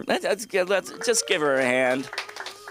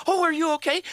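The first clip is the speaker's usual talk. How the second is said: fussing parent; faster than usual. Retold or imitated. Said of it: "you don't go".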